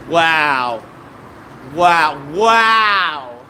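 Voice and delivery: stunned, stretching the word out; astonished wow. Acted. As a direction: let each wow grow in mock amazement. Acting out astonished reaction to Mark.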